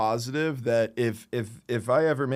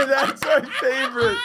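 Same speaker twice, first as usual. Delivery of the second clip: That's my favorite said much higher through a laugh; 12 semitones higher than usual. Real, not acted. Repeats favorite line laughing.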